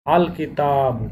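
আল কিতাবু with the লাম said slowly and distinctly; লাম স্পষ্ট করে ধীরে উচ্চারণ; clear separate lam. Shown as a demonstration. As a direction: spell out আল কিতাবু, lam crisp and apart. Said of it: "হরফটি স্পষ্ট এবং আলাদা করে উচ্চারণ করতে হবে".

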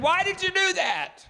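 a reporter yelling his disbelief at the Marine; retold shout. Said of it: "he shouted at the Marine".

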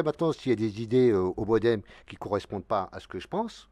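ordinary delivery

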